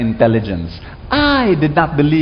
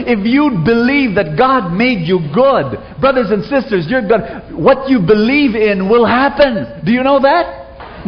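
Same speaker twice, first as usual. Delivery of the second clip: fervent preaching; 6 semitones higher than usual. Real, not acted. Preaching at full force.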